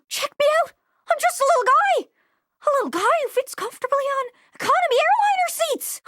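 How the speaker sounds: high, small-guy voice; little guy voice. Acted. A character showing off a new small body, high and chirpy. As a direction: chirp it like a tiny fellow. New tiny human form.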